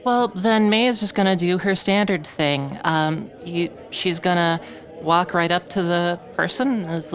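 ordinary talk, nothing marked

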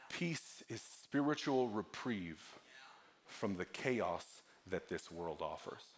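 low, soft, slow reflection on peace; hushed preaching. Real, not acted. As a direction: bring the sermon down to a reverent hush.

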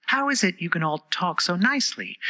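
put-on high, polite girl's voice; complimenting Alice. A narrator's reading. Alice hopes to improve its temper, high and polite. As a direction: flatter them in a high voice.

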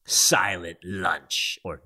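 very slow, drawn-out pronouncement; lunch attendant. Acted. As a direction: lay down the rule word by word. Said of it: "the tenants would say".

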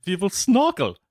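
high, loud put-on German accent; mock German accent. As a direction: play the German engineer again. Mock German tank dialogue again.

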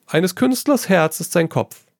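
light, amused artist lecturing his friend; Trevor, breezy painter. A narrator's reading. Painter Trevor teasing his friend Hugo, airy and high.

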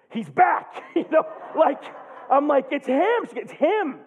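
high, loud cry of joy; joyful outburst. Real, not acted. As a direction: shout the good news with real joy. Joyful news stepfather is awake.

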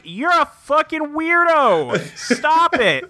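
high, drawn-out mock disbelief; exasperated retort. Acted appalled reply in retelling.

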